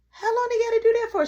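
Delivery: put-on a high quick child voice; curious little brother. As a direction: ask it like a nosy little boy. Little brother Caleb asking.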